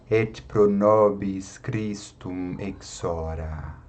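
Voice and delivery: slow, low Latin prayer reading; leitura de oração. Shown as a demonstration. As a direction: read the Latin prayer slowly and evenly, word by word. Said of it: "Eu só apenas vou ler a oração". (leitura lenta e pausada da oração em latim)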